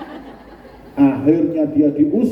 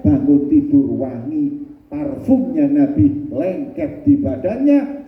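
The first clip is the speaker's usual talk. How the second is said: usual speaking voice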